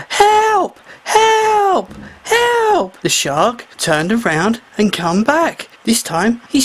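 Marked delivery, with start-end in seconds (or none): 0.1-3.0 very high, desperate cries for help; louder shouting. A narrator's reading. Trapped mermaid shouting louder to the shark. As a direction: scream for help even harder. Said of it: "So she shouted louder".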